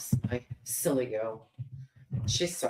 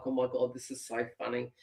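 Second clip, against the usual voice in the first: stifled laughter; 2 semitones lower, 5 dB quieter, faster than usual. Retold or imitated. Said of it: "my daughter was holding her mouth like".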